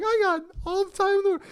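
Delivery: put-on very high, quick old-lady voice; elderly lady. Elderly customer reassuring the deli worker, forgiving. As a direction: imitate an unbothered old woman in a high pitch. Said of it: "it was an elderly lady".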